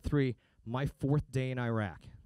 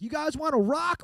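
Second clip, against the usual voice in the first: high, slow, hammed-up rock frontman; rocker hyping the crowd; 12 semitones higher, 6 dB louder than usual. Band greeting fans at a concert, hammed-up rock frontman. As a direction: shout it to a stadium crowd. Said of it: "they're like".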